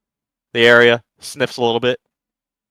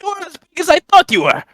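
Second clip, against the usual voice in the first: put-on booming, high Russian-accented character voice; stout foreign newcomer. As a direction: greet strangers in a high-pitched Slavic accent. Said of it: "and he's like".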